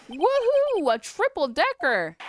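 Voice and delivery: high, loud cheer; gleeful high-pitched exclamation; excited whoop. Acted. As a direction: whoop it out in delight. Excited reaction to jumbo lunchbox.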